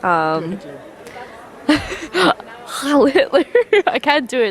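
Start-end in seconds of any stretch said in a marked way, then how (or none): 3.1-3.4 voice crack. Pretend. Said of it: "It's a little crack in the voice".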